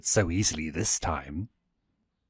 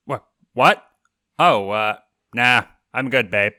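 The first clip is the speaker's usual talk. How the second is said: startled; 3 semitones higher, 8 dB louder than usual. Acted. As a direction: jolt out of a daydream, flustered. Startled, distracted reply.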